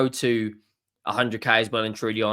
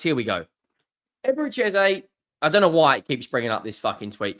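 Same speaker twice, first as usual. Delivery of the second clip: usual speaking voice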